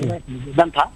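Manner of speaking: breaking up on a phone line; bad connection. Real, not acted. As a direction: voice cutting out over mobile. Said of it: "आपकी आवाज़ बहुत cut करके आ रही है". (कटती हुई फ़ोन की आवाज़)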